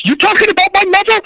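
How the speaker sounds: high and fast, a touchy Boston tough guy; offended tough guy. Retold or imitated. Southie guy hearing his mother insulted, high-pitched quick indignant bark. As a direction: snap back like someone just insulted your mother.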